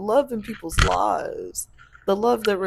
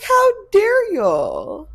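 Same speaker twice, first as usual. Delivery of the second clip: much higher and louder, slow, indignant; outraged "how dare"; 13 semitones higher, 9 dB louder, much slower than usual. Retold or imitated. To listeners about twisting love, indignant high slow outburst. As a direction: stretch it out in high, loud disbelief. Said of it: "And I'm like".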